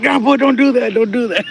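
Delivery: high, loud playful scolding of grandpa; mock scolding. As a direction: tell grandpa off, half laughing. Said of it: "I was like".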